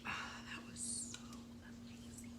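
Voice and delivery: breathy hushed whisper of delight. Real, not acted. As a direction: whisper it, savoring the taste. Savoring the soup.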